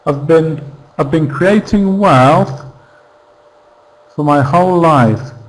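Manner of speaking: "I've been" squeezed short and unstressed before "creating wealth"; reduced contraction. Shown as a demonstration. Teacher showing how we would say it, reduced "I've been". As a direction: shrink "I've been" to a quick "uv bin", weight on "creating wealth". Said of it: "this is how we would say it".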